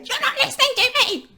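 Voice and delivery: high, fast whiny mimicry; mock the partner's whiny accusation; mock whiny complaint. Mimicking a complaining partner, high and whiny. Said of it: "They say".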